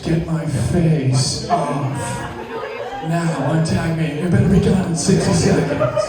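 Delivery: ordinary talk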